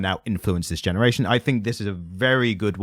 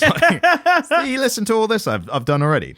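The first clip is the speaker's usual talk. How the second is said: laughing; 12 semitones higher, 3 dB louder than usual. Real, not acted.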